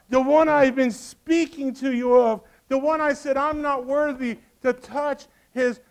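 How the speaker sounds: high, impassioned preacher's declamation; fervent proclamation. Acted. John presenting the one standing before you, fervent proclamation. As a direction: proclaim it high, building to the reveal.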